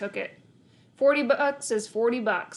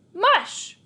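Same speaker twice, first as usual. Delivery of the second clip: high, loud call to the dog; bright, loud "come on, boy"; eager call; 11 semitones higher, 6 dB louder than usual. A narrator's reading. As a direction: cheer the dog on to get going. Urging husky like a sled.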